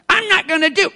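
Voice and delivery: put-on pouting little kid refusing; defiant child. Preacher mimicking a stubborn child, high and pouting.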